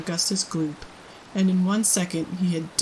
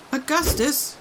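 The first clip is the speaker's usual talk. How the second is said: crying out; 8 semitones higher than usual. A narrator's reading. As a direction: call out urgently. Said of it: "cried Mister Gloop".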